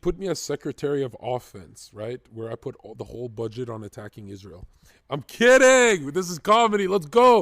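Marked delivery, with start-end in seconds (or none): 5.1-7.4 shouting it off. Real, not acted. Loudly walking back a joke.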